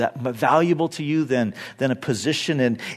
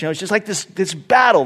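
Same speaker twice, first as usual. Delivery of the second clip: normal talking voice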